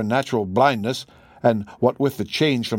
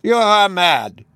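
louder, slow, high outburst; cry; 8 semitones higher, 6 dB louder, slower than usual. A narrator's reading. Old professor crying out at Holmes in fury. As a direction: fling the words at him. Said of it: "he cried".

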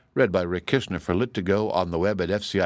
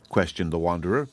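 usual speaking voice

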